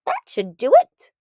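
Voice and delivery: a satisfied doctor finishing a job; cheerful doctor. A narrator's reading. Doctor pleased the cure worked, cheerful.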